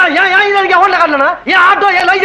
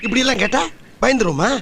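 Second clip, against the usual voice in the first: much softer hushed scolding; hushed argument; 4 semitones lower, 7 dB quieter than usual. Acted. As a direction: argue under your breath. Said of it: "மெதுவா பேசுங்க". (மாமனாருக்குக் கேட்காமல் மனைவியைத் திட்டுதல், தாழ்ந்த குரல்)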